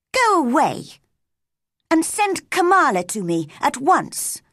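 put-on high, cross child giving orders. A narrator's reading. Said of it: "she asked crossly".

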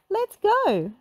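high eager call; rally the gang; Fred voice. A narrator's reading. Fred urging the gang on, high and eager. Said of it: "said Fred".

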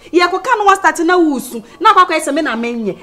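high, loud telling-off; angry scolding. Acted. Scolding someone for starting to cry, loud and angry.

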